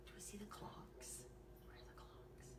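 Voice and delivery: low hushed whisper. Acted. Searching for clocks with the child in a whisper.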